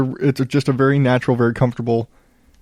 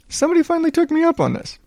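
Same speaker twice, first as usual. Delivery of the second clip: much higher and fast, a burst of delight; excited; 13 semitones higher, much faster than usual. Real, not acted. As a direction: blurt it out, thrilled at last. Finally someone left a review.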